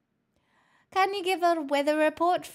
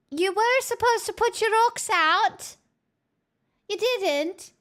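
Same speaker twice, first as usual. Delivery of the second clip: mock scolding; 6 semitones higher than usual. Streamer tells chat off for not charging their rocks, mock angry.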